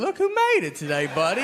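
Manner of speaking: high, sing-song praise for a grown man; patronizing cheer. Retold or imitated. Teachers greeting an adult who finally showed up to class, mock cheery. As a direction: cheer him on like a proud teacher.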